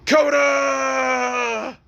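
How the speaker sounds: long drawn-out shout, high and loud; acted furious bellow; outraged yell. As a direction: holler the name, stretching the last vowel. Deku yells at discovering the photos.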